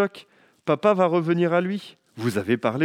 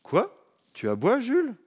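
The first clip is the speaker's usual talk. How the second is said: surprised dad; 9 semitones higher than usual. Acted. Papa surprised.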